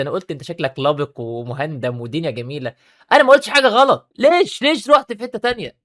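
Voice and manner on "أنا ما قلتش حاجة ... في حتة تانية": indignant protest. Real, not acted. Protesting an accusation.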